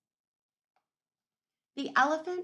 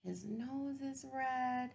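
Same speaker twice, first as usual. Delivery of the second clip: plain reading voice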